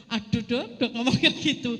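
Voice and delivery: loud mock-dismayed objection; retold protest. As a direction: protest loudly to the doctor. Protests the doctor's grim warning, retold. (menirukan protes keras kepada dokter)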